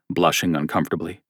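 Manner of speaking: ordinary delivery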